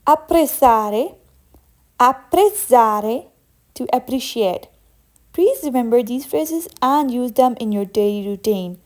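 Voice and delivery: ordinary talk, nothing marked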